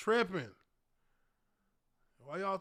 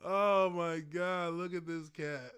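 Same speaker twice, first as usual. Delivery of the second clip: ordinary talk, nothing marked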